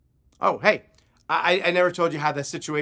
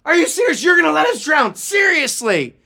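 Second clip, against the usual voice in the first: high, loud disbelieving shout; incredulous screaming; outraged scream; 13 semitones higher, 7 dB louder than usual. Retold or imitated. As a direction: yell in furious disbelief at being abandoned. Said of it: "I screamed, I'm like".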